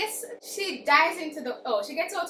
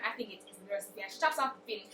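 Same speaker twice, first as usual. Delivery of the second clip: ordinary delivery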